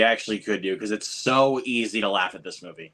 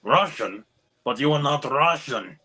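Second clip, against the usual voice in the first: put-on Russian accent; lightly hammed-up Russian accent; mock Russian accent. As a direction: play a puzzled Russian. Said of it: "Why did that sound Russian?".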